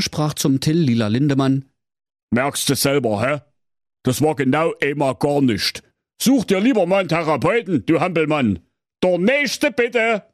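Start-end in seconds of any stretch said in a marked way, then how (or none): 2.3-10.2 grumpy king. Acted. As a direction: dismiss him like a bored monarch. King mocks singer.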